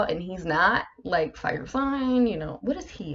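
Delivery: ordinary delivery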